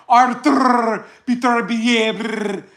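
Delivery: slow, exaggerated fake Russian accent; mock accent. Imitating West Coast channels, slow fake Russian accent. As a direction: drag out the name in a mocking Russian accent. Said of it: "they be like".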